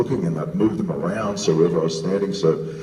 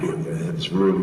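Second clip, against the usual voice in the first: ordinary talk, nothing marked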